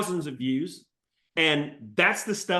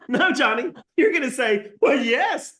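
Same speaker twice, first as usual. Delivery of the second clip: higher and louder, pushing the point home; emphatic excitement; 8 semitones higher, 6 dB louder than usual. Real, not acted. Coach urging Johnny to accept the lead, excited and loud. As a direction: cheer him on to say yes.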